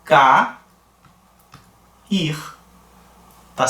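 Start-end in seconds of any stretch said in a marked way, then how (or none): 0.0-2.4 ca, then ir, with a long pause between; split into syllables. Shown as a demonstration. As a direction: say ca, stop, then ir. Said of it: "Vamos separar as sílabas?". (separando as sílabas: ca, pausa, ir)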